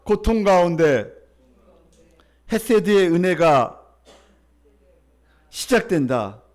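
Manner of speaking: 크게 천천히 외치는 선창; 한 마디씩 끊어 회중에게 선창; call to repeat. Shown as a demonstration. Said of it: "첫 번째 대지 따라할까요?". (회중이 따라하도록 크게 천천히 선창)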